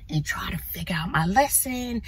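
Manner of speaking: unvoiced aside under the breath; hushed whispered aside. Real, not acted. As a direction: whisper it like a secret. Aside in lowered voice.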